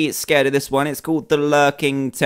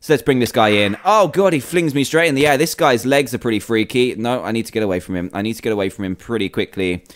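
ordinary delivery

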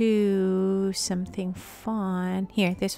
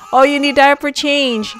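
excited call out; 5 semitones higher, 12 dB louder than usual. Real, not acted. Urgent baby care instructions.

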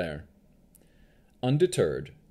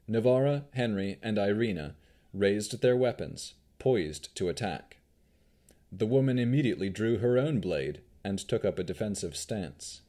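ordinary delivery, nothing marked

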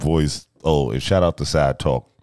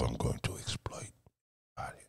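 whisper; 4 semitones lower, 14 dB quieter, faster than usual. Real, not acted. Joking aside.

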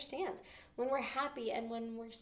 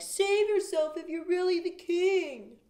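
higher, louder, slow jeering call; mocking cry; 9 semitones higher, 10 dB louder, slower than usual. Teacher voicing the crowd jeering at Jesus, loud slow taunt. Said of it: "who cried out things to Jesus".

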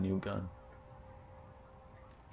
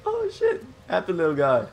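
excited squeal; 7 dB louder than usual. Real, not acted. Player squealing at a happy little alien creature, very high and excited. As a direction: squeal with delight at a cute pet.

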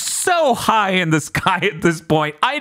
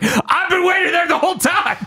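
higher shouted villain line; villain gloating shout; 11 semitones higher than usual. Retold or imitated. Villain bursting out of the water, gloating shout. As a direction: ham up a villain's triumphant shout. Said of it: "It's just like".

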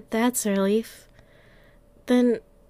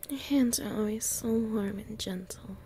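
much softer, whispered to the owner; tender whisper; 10 dB quieter than usual. Acted. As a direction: breathe it out while melting into the petting. Cuddling, being petted.

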